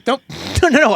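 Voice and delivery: high, loud, laughing no no no; flustered denial. Real, not acted. Caught out, flustered denial to co-host, laughing. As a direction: hurriedly take it back, laughing.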